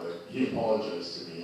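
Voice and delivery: ordinary delivery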